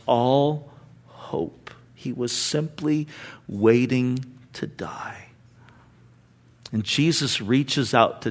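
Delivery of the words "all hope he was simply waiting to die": much softer, a breath of sorrow; whispered, somber. Real, not acted. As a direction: whisper it, heavy and sad. Hushed, somber.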